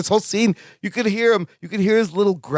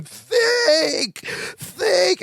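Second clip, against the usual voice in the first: high mock yell of Think; imitated yell; 11 semitones higher than usual. Larry yelling Think at Balki, high mock shout. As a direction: bark it like an exasperated roommate. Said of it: "yells him again".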